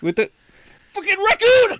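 high, loud shriek of alarm; mock terror, screamed at the top of the range; panicked scream. Host spots a raccoon on the chair, play-acted panicked scream. Sudden raccoon attack skit.

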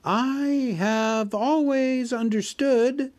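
a small scared voice daring to speak; frightened squeak. Acted. Hobbit squeaks nervously to the dragon. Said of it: "in a frightened squeak".

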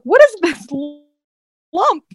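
high, drawn-out squeaky disbelief; mock shock. Mother first sees her huge baby, comic high disbelief. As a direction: exaggerate comic bewilderment, high and slow.

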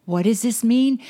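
ordinary talk

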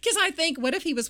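high laughing burst. Real, not acted. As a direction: laugh right through the words. Laughs about missed chance.

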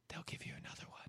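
breathy secret aside; whispering. Acted. Whispered ad line.

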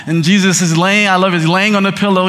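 normal talking voice

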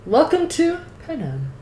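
high showy announcer voice. Acted. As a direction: announce it like a game show host. Announcing the book world.

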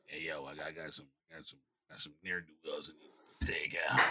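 low, gravelly whispered cop voice; dirty cop voice. Acted. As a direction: growl it like a corrupt cop. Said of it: "I went to my dirty cop voice".

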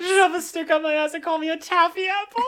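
very high squeal of delighted mimicry; gleeful mimicry. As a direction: quote it back while cracking up. Repeats the joke while cracking up.

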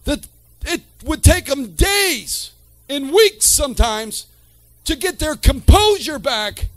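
preaching at full force. Real, not acted. Preacher stirs the congregation about weeping, fervent preaching. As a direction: build the sermon to a fervent peak.

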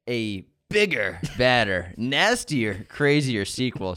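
slow, dramatic trailer narration; movie trailer voice. Acted. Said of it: "I like your trailer voice".

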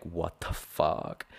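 low, slow, stunned mutter of disbelief; stunned onlookers. Retold or imitated. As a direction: drag it out like a shocked onlooker. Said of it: "people were looking like".

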